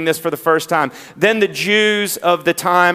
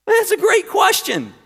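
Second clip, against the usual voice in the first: excited shout. Real, not acted. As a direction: burst out with delight at the question. Pastor answers own question.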